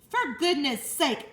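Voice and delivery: loud, slow exasperated bellow. Acted. Drenched farmer scolding Lurvy, slow loud bellow. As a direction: drag it out, fed up. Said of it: "bellowed Mister Zuckerman".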